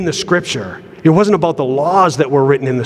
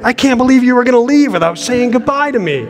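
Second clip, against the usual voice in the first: put-on hurt little kid; 10 semitones higher than usual. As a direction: voice a child shocked at being left. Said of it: "looking at me like".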